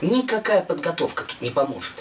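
usual speaking voice

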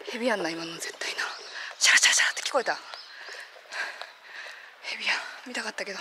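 whispering so the snake won't hear; hushed whisper. Real, not acted. Filming near a hidden snake, whispering to viewers.

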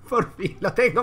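joyful laughing cry. Real, not acted. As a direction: laugh out the victory. Joy after success.

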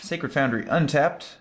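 ordinary talk

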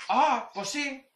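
high, drawn-out gullible reply; mock dim agreement. The friend swallowing the bait, agreeing dopily. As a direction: agree like you just got fooled. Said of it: "el otro dice".

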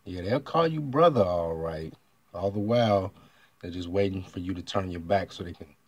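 ordinary talk

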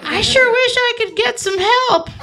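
high, pleading voice, mocking the reality show woman. Mocking the woman on the show, high pleading voice. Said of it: "she's like".

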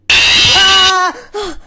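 loud, high startled scream; fright scream. Real, not acted. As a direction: scream in sudden real shock. Jump scare while punching himself.

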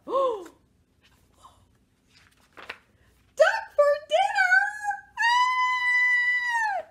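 very high, loud panicked scream; horrified shriek. Acted. Mouse hearing duck is dinner, high terrified shriek. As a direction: shriek the words into a long scream.

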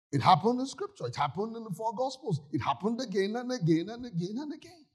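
rising pulpit repetition; preaching build. Real, not acted. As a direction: hammer it home like a preacher.

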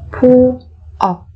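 slow, separated syllables for learners; careful teacher's slow model. Shown as a demonstration. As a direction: spell it out slowly, beat by beat.

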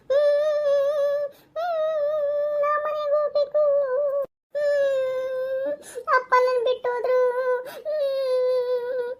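put-on high child voice, whimpering; crying little boy. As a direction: whine like an abandoned kid. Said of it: "ಯಾಕಪ್ಪ ಅಳ್ತಾ ಇದ್ದಾನೆ?". (ಅಳುತ್ತಿರುವ ಪುಟ್ಟ ಹುಡುಗನ ಧ್ವನಿ)

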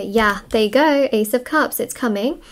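ordinary delivery

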